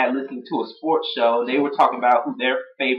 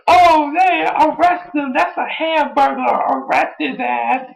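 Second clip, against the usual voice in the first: The Rock impression; 13 semitones higher, slower than usual. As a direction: yell it like a cartoon cop. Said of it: "he's like".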